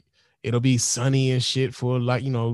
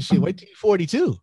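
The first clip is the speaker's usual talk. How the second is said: wry comeback; 6 semitones higher, 3 dB louder than usual. Retold or imitated. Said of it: "I said".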